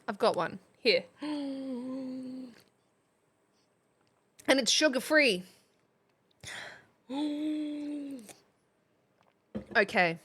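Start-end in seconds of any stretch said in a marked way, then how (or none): 1.2-2.4 soft gasp. Real, not acted. Gasp at Red Bull gift. 7.1-8.1 soft gasp. Real, not acted. Second gasp sugar-free.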